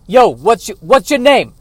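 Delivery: high, loud mobster challenge; mafia boss. Acted. Mafia boss questioning an impostor, loud and high. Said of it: "in front of other mafia bosses".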